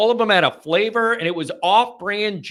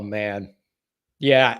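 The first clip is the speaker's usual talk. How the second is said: normal talking voice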